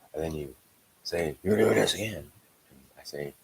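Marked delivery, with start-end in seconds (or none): 1.4-2.3 loud, higher-pitched mock accusing; imitated quarrel, voice raised; retold accusation. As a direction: throw the accusation out forcefully. Acted repeated accusation in quarrel.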